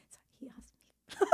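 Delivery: whisper. Real, not acted. Collecting herself before telling story.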